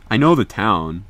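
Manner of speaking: usual speaking voice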